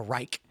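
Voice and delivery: "Reich" with a hard k at the end; Reich as "rike". Shown as a demonstration. Host offers another guess at the name, "Reich" pronounced "rike". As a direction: say "Reich" to rhyme with "bike". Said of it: "don't know how to pronounce his name".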